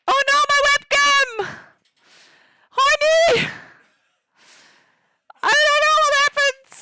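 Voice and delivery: real high-pitched, ear-splitting shriek; panicked scream. Real, not acted. Streamer yelling that the webcam fell, panicked scream. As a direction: scream it at the top of your voice. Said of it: "so sorry if you guys are deaf now".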